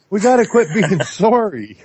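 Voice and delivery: words broken by a real laugh; laughing through it. Real, not acted. Urging fellow Canadians, laughing. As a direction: chuckle the words out. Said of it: "laughing while saying it".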